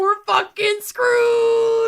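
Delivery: high, slow, drawn-out despair; slow despairing cry. Real, not acted. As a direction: wail it out in mock despair. Despairing exclamation.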